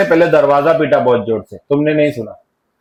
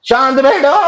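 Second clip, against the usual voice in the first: higher, mock shout of shaant baitho; retold shout to sit quietly; 7 semitones higher than usual. Teacher mimics a colleague yelling at the class, a higher mock shout. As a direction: imitate the staff member calling for silence, pitched up. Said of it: "उसने अभी जोर से चिल्लाया".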